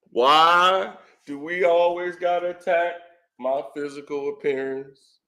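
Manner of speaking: mock whining. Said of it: "He'd be crying and whining".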